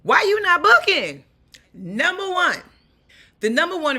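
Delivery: excited. Real, not acted. Energetic opening hook.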